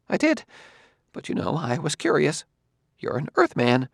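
a hint of a nervous scholar; Quemot's voice. A narrator's reading.

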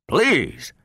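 mildly irritated appeal; half-irritated begging. A narrator's reading. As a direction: beg with a touch of irritation. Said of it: "half in irritation".

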